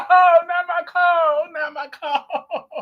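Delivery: fake-sob over the soap character, laughing; mock ugly cry. Mock ugly cry over a dead soap character, laughing. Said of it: "Let me get my ugly cry out".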